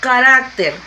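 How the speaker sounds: "carácter" with RAC punched out; stress on penultimate syllable. Shown as a demonstration. Teacher giving an example of a grave word, stress on RAC in "carácter". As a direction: lean firmly on RAC in "carácter". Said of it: "lleva acento en la penúltima sílaba".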